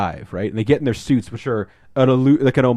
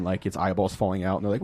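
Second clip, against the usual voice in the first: ordinary delivery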